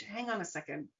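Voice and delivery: soft, measured talk to yourself; hushed, self-observing realisation; calm acknowledgement. Retold or imitated. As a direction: step back and quietly admit it. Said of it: "come up here and acknowledge".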